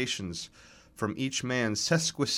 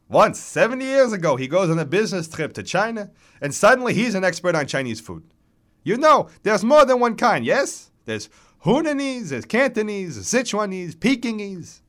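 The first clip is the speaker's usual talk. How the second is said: put-on high, loud, vigorous accented old man; mock your rival in a reedy, worked-up voice; chirping old man on a sarcastic rant; 10 semitones higher, 8 dB louder than usual. A narrator's reading. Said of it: "His voice is reedy but vigorous".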